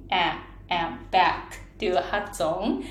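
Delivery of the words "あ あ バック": "あ、あ" as English æ, then "バック" as English back; flat-a English vowel. Shown as a demonstration. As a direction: lower isolated "あ" with the vowel of cat, carried into "バック". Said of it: "このフラットへのこの母音".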